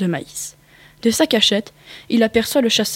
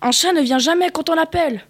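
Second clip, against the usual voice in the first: higher, severe lesson voice; stern teacher; 6 semitones higher than usual. A narrator's reading. Rabbit teaching the dog cat rules, lightly stern. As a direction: lay down the rule sternly. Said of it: "d'un ton sévère".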